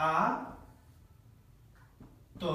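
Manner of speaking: ordinary talk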